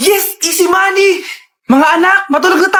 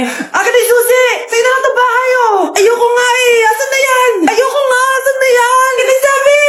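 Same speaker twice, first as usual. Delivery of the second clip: crying shouting quarrel; 7 semitones higher than usual. Acted. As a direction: scream and sob over the car keys. Siblings fight over inheritance.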